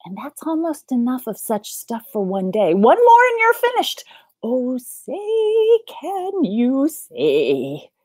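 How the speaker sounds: animated reading, building to a high, loud finish; theatrical story reading. A narrator's reading. Storyteller nearing the book's end, big theatrical read. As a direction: stretch the last line out grandly.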